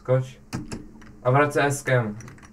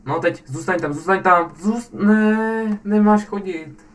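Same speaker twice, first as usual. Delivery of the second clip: excited urging; 7 semitones higher, 8 dB louder, slower than usual. Real, not acted. Urging a player in the game.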